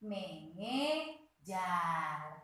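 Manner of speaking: "Mengejar" stretched very slowly, me-nge-jar; slow syllable reading. Shown as a demonstration. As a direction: spell "Mengejar" out slowly for children. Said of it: "Jadi bacanya harus nge". (ucapkan "Mengejar" sangat pelan, per suku kata)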